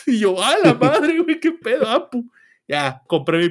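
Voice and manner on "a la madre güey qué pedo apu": amazed. Retold or imitated. Shocked at the Apu cashier.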